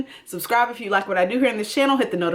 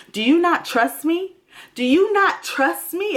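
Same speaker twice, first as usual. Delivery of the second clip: higher, slow, drawn-out offended questioning; mock indignation; 6 semitones higher, slower than usual. Cheater deflecting a partner's question, mock indignation. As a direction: act wounded and affronted, stretching the words.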